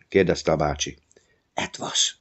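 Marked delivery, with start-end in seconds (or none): 1.5-2.2 breathless secret whisper. A narrator's reading. Boy whispers the monster's name to his uncle, whispering.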